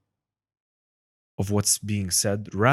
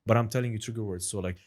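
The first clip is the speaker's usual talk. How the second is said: ordinary talk, nothing marked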